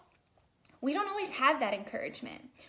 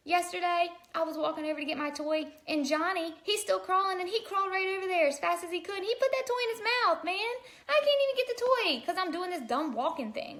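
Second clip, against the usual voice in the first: put-on much higher, fast, whiny kid voice; frustrated baby; 7 semitones higher, 3 dB louder than usual. Baby complaining his crawling friend grabbed the toy, high whiny rant. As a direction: vent like a toddler cheated of his toy. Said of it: "if babies had this conversation".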